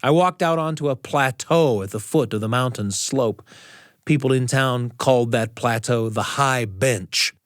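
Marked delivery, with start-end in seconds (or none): none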